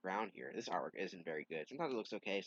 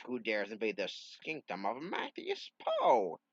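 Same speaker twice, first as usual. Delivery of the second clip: slow, a bit louder, commanding; lightly voiced villain; 4 semitones higher, 6 dB louder, slower than usual. Matthias Poe challenges the intruder, slow and commanding. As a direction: boom it out like a hammy wizard.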